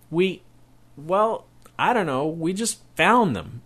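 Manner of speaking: put-on a boy caught, fumbling for words; flustered boy. Caught boy stammers an excuse.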